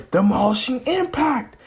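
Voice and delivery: high-pitched mock battle cry; anime attack call. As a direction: call out the special move. Anime attack name called out.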